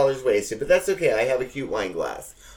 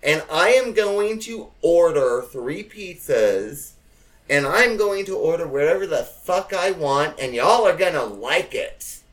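defiant declaration; 5 semitones higher, 5 dB louder than usual. Retold or imitated. Announcing the pizza order to the family, defiant. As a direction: lay down the law, no arguing. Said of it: "So finally I said".

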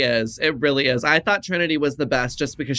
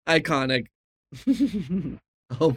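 ordinary talk

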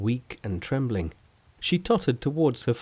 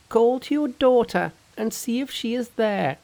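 high, perky monkey king voice; a cocky trickster, pitched up; cheeky monkey hero; 12 semitones higher, 4 dB louder than usual. Acted. Monkey's voice in read story.

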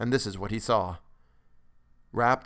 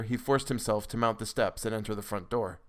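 ordinary delivery, nothing marked

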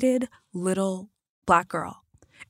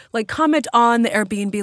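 usual speaking voice

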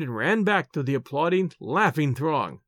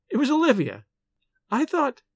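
higher playful voice; light higher heroine; lightly voiced heroine; 9 semitones higher than usual. A narrator's reading. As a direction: tease him gently.

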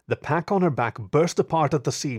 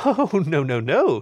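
playful tut-tut, higher and louder; chiding; 5 semitones higher, 5 dB louder, slower than usual. Acted. Thief chiding the inspector, mock scolding. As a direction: wag a finger with the voice. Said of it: "she chided".

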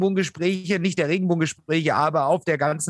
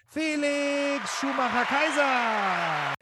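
drawn-out high boxing ring shout; ring announcer; 6 semitones higher, 3 dB quieter, slower than usual. Acted. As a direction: bellow the name like a fight announcer. Introducing guest like ring announcer.

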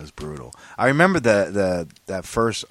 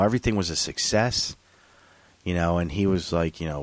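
ordinary talk

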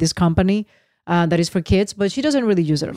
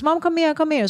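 daughter calling; 9 semitones higher than usual. Retold or imitated. Daughter calling mom over.

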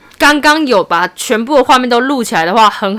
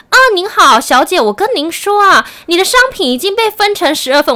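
higher imitation of a Mainland Chinese accent; 7 semitones higher than usual. Scam caller telling the buyer about her order, Mainland Chinese accent. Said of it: "你现在用中国腔来跟我说话".

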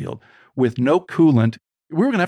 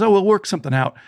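higher, breezy reassurance; easygoing mechanic; 7 semitones higher, faster than usual. Retold or imitated. Mechanic waving off the father's worry about money. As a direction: wave the worry away lightly. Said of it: "And the guy says".

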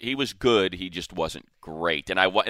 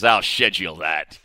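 put-on Siri with an Australian accent; loud impression of an Australian voice assistant; 5 dB louder than usual. Man showing off his phone's voice, Australian accent. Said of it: "my Siri's got an Australian accent".

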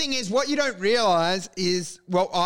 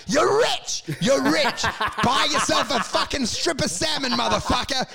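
hyped-up salesman; 6 semitones higher than usual. Acted. Broker telling a client they are rich, exultant. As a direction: proclaim it like a jackpot win.

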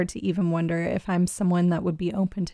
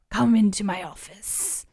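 creeping nightmare whisper; 3 semitones higher than usual. Pretend. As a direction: rasp it out like a creepy host. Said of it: "in this weird raspy whispery voice".

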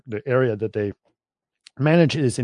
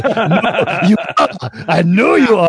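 put-on French accent; 6 semitones higher, 5 dB louder than usual. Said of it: "He goes".

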